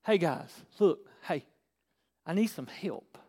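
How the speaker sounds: soft, low, halting appeal for help; seeking. Shown as a demonstration. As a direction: go looking for help, calling quietly to people.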